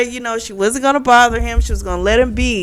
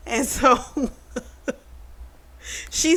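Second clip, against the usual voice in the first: laughing; 4 semitones higher, 6 dB quieter than usual. Real, not acted. Laughing while telling.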